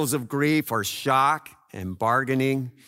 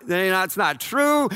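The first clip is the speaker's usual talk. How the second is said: normal talking voice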